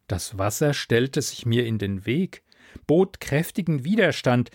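ordinary delivery

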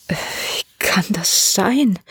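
very slow, whispery faltering; stunned stammer. A narrator's reading. The old man searches for understanding, stunned stammer. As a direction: grope for the words in disbelief, almost voiceless. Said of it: "stammelte der alte Mann".

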